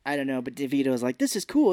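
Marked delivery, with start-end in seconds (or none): 1.2-1.7 gleeful delight. Retold or imitated. DeVito enjoying wild ride.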